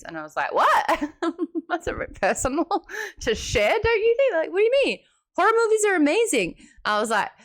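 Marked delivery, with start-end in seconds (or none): 0.5-5.0 high-pitched, giggling disbelief; mock startled. Host retelling her shock at a guest's confession, mock startled. 5.4-6.6 high, quick retold gushing; mock enthusiastic. Retelling the guest defending horror movies, mock enthusiastic.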